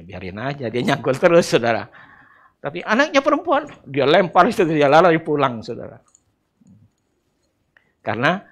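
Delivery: ordinary talk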